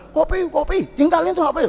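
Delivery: high, sing-song mock demand for coffee. Husband nagging wife about coffee, sing-song mock demand. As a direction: chant the order for coffee up high. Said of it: "marah-marah".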